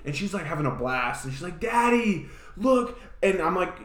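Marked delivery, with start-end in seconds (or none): 1.6-3.0 little daughter. Retold or imitated. Voicing young daughter on bike.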